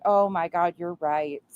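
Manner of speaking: usual speaking voice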